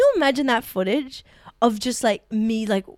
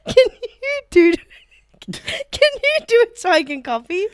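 laughing plea; 12 semitones higher, 6 dB louder than usual. Real, not acted. Comic asking friend for an accent to copy, laughing plea. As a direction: ask helplessly while cracking up. Begging for a demo amid laughter.

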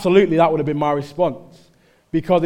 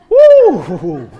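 loud, high swaggering "woo"; cocky whoop; 7 semitones higher, 11 dB louder than usual. Acted. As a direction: whoop like you're untouchable. Acts out untouchable kid's swagger.

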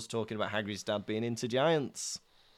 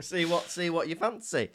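ordinary talk, nothing marked

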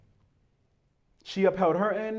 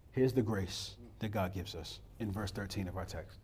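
hushed preaching; 8 semitones lower, 14 dB quieter than usual. Acted. Quiet intimate preaching.

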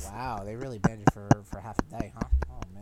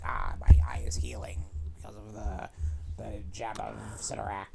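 whisper; 5 semitones lower, 5 dB louder than usual. Retold or imitated. Character voiced, whisper.

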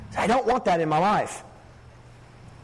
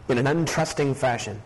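lower, measured pleading prayer to God; earnest prayer; 8 semitones lower than usual. Acted. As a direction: pray it sincerely, unhurried. Pastor prays before the message.

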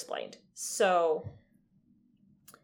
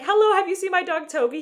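put-on high, loud, bright little-girl greeting; chirpy girl voice; 10 semitones higher, 7 dB louder than usual. Retelling Gina's line to cohost, high chirpy girl. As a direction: pitch way up and chirp it like an eager child. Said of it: "Gina's like".

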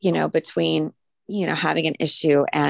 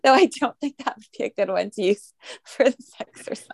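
talking through real laughter, higher pitched; laughing; 4 semitones higher than usual. Real, not acted. Teacher joking with students about the exercise, laughing. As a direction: get the words out while laughing.

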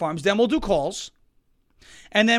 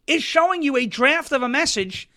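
ordinary talk, nothing marked

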